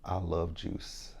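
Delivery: slow, soft and drawn out; said slowly. Shown as a demonstration. Vlogger shows viewers the lip-reading trick, slow. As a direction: stretch out each word of I love juice, hushed. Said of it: "you kinda say it slow".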